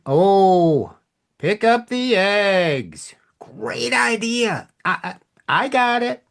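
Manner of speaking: high, slow, exaggerated sarcasm, partly whispered; sarcastic. Shown as a demonstration. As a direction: drag it out and mock the idea. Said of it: "Same words, whole different meaning".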